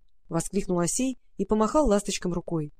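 usual speaking voice

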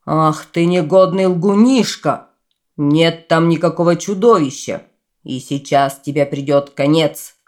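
loud, furious scolding; angry outburst. Acted. Dragon yells at the rabbit, loud furious scolding. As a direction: blast the little liar.

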